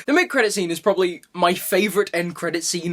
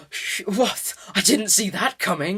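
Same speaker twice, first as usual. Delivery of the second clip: hammed-up gasp of fake astonishment; mock shock; 3 semitones higher than usual. Reviewer telling viewers of a predictable twist, mock shock. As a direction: pretend to be stunned by a twist you saw coming.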